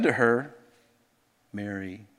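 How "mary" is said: tender call. Shown as a demonstration. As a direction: softly, lower and much quieter, a loving call of the name "Mary". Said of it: "He said it in a way that she instantly knew".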